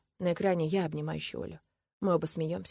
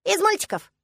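put-on high, loud little-girl declaration; absolutely confident child; 8 dB louder than usual. A narrator's reading. Girl answering how she knows, utterly certain. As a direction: state it as obvious fact. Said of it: "заявляет она с абсолютной уверенностью".

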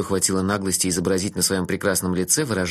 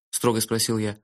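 usual speaking voice

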